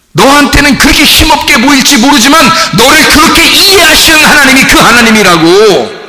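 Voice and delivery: usual speaking voice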